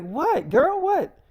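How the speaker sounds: put-on a high, loud, disbelieving what, girl; shocked what. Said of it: "She's like".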